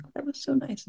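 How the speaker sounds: dreamy, high and soft, savouring it; mock contentment, lightly floated up; soft high sigh of pleasure; blissful sigh. Imagined pleasant sitting thought.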